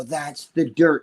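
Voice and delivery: slow, drawn-out catchphrase; leisurely mimicked priest line; imitating the priest. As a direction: stretch the words out as a family in-joke. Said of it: "sometimes we'll go".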